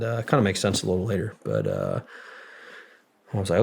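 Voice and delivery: ordinary talk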